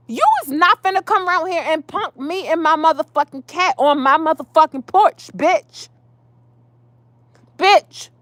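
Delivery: high, worked-up telling-off; heated, high-pitched outrage; indignant warning. Real, not acted. Worked-up warning to absent neighbor.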